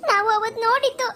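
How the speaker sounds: usual speaking voice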